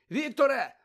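loud high call into the dark; searching call for a missing friend. A narrator's reading. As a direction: shout his name across the trees. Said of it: "погукав Гаррі".

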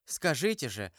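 ordinary delivery, nothing marked

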